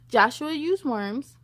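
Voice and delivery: plain reading voice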